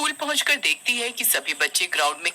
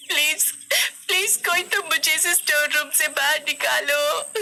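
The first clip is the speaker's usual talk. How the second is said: high, wailing cries for help; hammed-up terrified child crying; sobbing plea; 8 semitones higher than usual. A narrator's reading. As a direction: sob between desperate pleas. Trapped Kosi cries for help.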